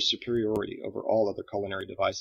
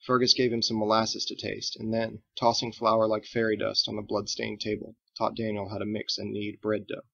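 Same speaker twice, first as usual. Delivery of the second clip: ordinary delivery, nothing marked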